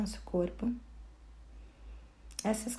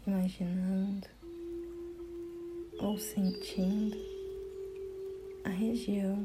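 guided meditation; 9 semitones higher, 4 dB quieter than usual. Acted. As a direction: guide the listener gently inward, phrase by phrase. (meditação guiada, voz pausada e tranquila)

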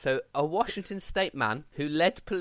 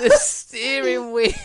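high, loud, slow words breaking into laughter; laughing exclamation; 10 semitones higher, 9 dB louder, slower than usual. Real, not acted. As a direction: draw it out while cracking up. Still cracking up at the mistake.

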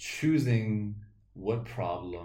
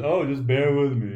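mock bear pun; 5 semitones higher, 8 dB louder, slower than usual. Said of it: "he says".

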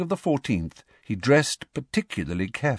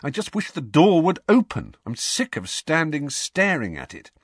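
higher, mildly angry complaint; angry frustration; 7 semitones higher than usual. A narrator's reading. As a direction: vent fed-up frustration. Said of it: "He rubbed his forehead angrily".

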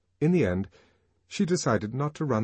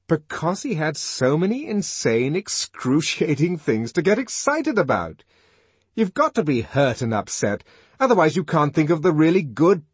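raised, louder, emphatic lecturing; animated arguing; 6 semitones higher, 6 dB louder than usual. A narrator's reading. Helmholtz explaining to the Savage what writing needs, animated. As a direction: argue the point with mounting zeal.

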